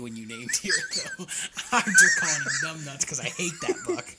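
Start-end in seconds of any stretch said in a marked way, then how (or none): 1.7-4.0 quick, loud, laughing protest. Real, not acted. Hunter defending a buck's nickname to friend, laughing. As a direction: burst into laughter mid-explanation.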